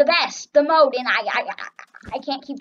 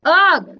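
loud, higher-pitched "ugh"; exasperated groan; 5 semitones higher, 11 dB louder than usual. Real, not acted. Player dreading a game with no complaining, exasperated groan.

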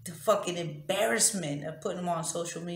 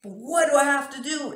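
louder anguished yell; 8 semitones higher, 6 dB louder than usual. Shown as a demonstration. Woman showing her real volume in pain, louder anguished yell. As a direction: stretch the plea into a yell. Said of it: "it's actually louder than that".

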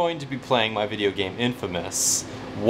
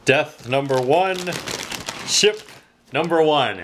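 excited count; 4 semitones higher than usual. Real, not acted.

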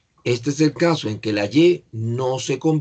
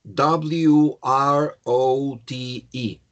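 very slow, stretched letters; slow letter-by-letter spelling; spelling aloud; much slower than usual. Shown as a demonstration. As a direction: dictate each letter separately. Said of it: "el spelling de wrote".

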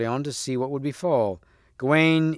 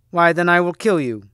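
louder, higher bold threat; exclaiming; 5 semitones higher, 5 dB louder than usual. Acted. Percival threatens the Red Knight, exclaiming. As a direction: declare it boldly, voice raised. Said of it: "exclaimed Percival".

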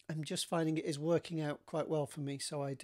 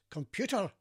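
higher-pitched mock call to a machine; Scotty; 4 semitones higher than usual. Engineer addressing the computer in front of him, imitated hail. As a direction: imitate a film engineer hailing his computer. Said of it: "they sit Scotty in front of the computer and he goes".

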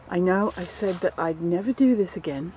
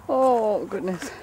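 usual speaking voice

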